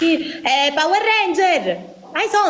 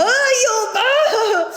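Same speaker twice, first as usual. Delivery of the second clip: pained cry; 9 semitones higher than usual. Real, not acted. Toothpaste burning under nose.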